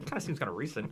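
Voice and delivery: underplay it with a bored sneer; sarcastic dryness. Retold or imitated. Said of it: "I love the sarcastic dryness".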